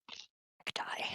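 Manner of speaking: hushed whisper. Real, not acted. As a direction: breathe it out in awe. Spiky plants described.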